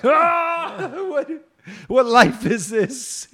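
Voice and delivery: very high, loud mock yell, then drawn-out words; waking up bewildered. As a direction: jolt awake in hammed-up confusion. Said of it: "He just woke up like".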